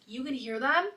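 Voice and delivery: acted surprised question. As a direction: ask it back in mild astonishment. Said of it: "And then she's like".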